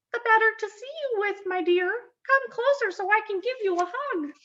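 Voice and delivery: high, quick fake grandmother voice; wolf posing as grandmother. A narrator's reading. Wolf luring Red closer, posing as grandmother in high voice. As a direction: coax sweetly in a high put-on granny voice.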